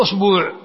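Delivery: أصبوع said usbuu', long u after the b; long u form. Shown as a demonstration. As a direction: demonstrate أصبوع as us-BOO'. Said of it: "وزد أصبع".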